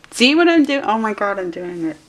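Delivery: high, loud sudden self-catch; excited outburst. Real, not acted. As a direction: burst out catching yourself in the act. Catches herself agonizing, excited.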